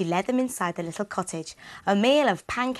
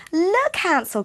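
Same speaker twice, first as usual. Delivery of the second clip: higher, louder call of delight; excited cry; 10 semitones higher, 5 dB louder, slower than usual. Acted. Gretel spots the cottage, cries out higher and louder. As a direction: call out to your brother in wonder. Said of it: "cried Gretel".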